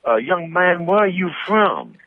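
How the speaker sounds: put-on a sweet old Texas lady in a Southern drawl; asking a young man where he's from, in a high slow Southern drawl; Southern Belle drawl. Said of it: "I had a drawl".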